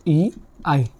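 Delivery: drawn-out letter names, one at a time; slow spelling out. Shown as a demonstration. Teacher spelling a letter pair slowly for students. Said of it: "जैसे".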